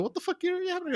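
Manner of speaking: mock outrage. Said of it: "They said".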